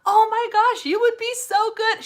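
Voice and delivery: high, loud gushing friend; excited friend. Retold or imitated. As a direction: gush like an excited friend cheering her on. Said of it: "And she's like".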